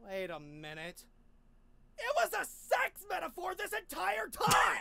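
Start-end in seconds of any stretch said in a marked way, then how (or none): 2.0-4.8 shocked shout. Acted. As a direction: shout the discovery. Shouted realization.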